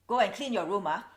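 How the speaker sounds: usual speaking voice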